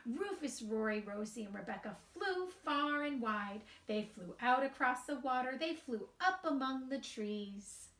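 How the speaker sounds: slow, sing-song storybook reading; gentle storybook narration with light character voices; reading to children. A narrator's reading. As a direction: read it aloud to a group of little kids. Reads picture book to children.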